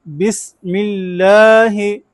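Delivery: slow recitation of बिस्मिल्लाहि with a hissing seen; whistled seen. Shown as a demonstration. Teacher models बिस्मिल्लाहि slowly for students. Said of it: "इसकी सीटी की आवाज़ आएगी".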